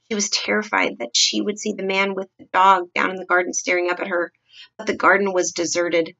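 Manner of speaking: plain reading voice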